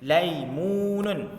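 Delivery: Laimunun drawn out slowly, every syllable clear; Arabic word said slowly. Shown as a demonstration. Teacher models Laimunun slowly for pupils. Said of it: "kita panggil laimunun".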